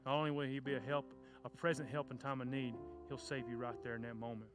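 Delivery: ordinary talk, nothing marked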